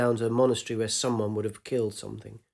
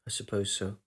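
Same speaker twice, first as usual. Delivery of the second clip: softer, lower murmur; quiet; 3 semitones lower, 7 dB quieter than usual. A narrator's reading. Young man answering his lover, quietly withdrawn. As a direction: let it drop away, withdrawn. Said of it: "His voice was quiet".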